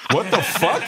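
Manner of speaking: high, laughing what the fuck; stunned disbelief. Retold or imitated. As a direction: replay the shock of being recognized. Stunned at meeting George Carlin.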